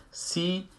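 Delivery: "سی" as a long "see" through spread lips; smiling kasra. Shown as a demonstration.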